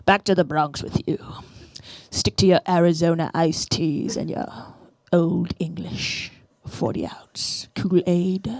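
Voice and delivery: hushed, slowly sneering dismissal; mock sneering whisper. Snobs send the Bronx girl home with a slow sneering whisper. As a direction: whisper the put-down, dragging it out.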